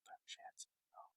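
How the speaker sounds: breathy whispered lyric; whispering. Pretend. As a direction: whisper it under your breath. Mouthing theme lyric.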